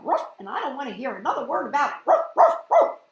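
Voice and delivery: barking dog character. Acted. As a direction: rattle it off then bark ruff ruff.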